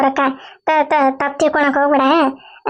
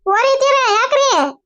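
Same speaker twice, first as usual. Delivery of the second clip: high slow villager voice; 8 semitones higher, slower than usual. Acted.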